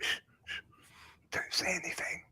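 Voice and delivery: hushed whisper to keep quiet. Real, not acted. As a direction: whisper so they won't hear. Others coming back, hushing.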